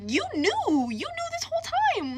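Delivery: high, pained accusation at the friend; hurt and furious, jabbing at him; mad. Retold or imitated. Confronting her boyfriend's best friend, high and furious. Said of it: "I was, I was mad".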